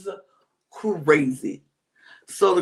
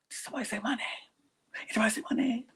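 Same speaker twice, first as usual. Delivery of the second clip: whispering; 4 semitones higher, 12 dB quieter, much faster than usual. Retold or imitated. Retelling whispered plotting.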